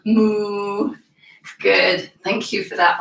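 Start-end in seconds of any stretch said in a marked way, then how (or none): 0.1-1.0 low cow moo. Pretend. Kids yoga cat cow sounds.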